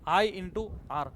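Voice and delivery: I into R drawn out slowly; slow prompt. Shown as a demonstration. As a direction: say I into R slowly so they repeat it. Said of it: "मोठ्याने बोला". (हळू, विद्यार्थ्यांनी म्हणावे म्हणून)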